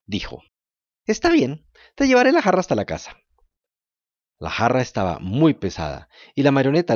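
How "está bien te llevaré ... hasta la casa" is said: put-on high, eager wooden-puppet boy; a thirsty puppet boy begging politely. To the kind woman, Pinocho asks for water in a high puppet voice. Pinocho agrees to carry jar.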